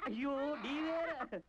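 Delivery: slow, high, crying moan; weepy wail.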